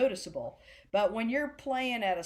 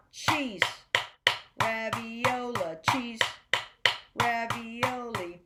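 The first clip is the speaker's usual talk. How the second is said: "cheese" short, long rest, "ravioli" slow and even; subdivided rhythm; slower than usual. Shown as a demonstration. Music teacher showing students what you want, subdivided rhythm. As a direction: hold a full beat of silence around a stretched "ravioli". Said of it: "You want".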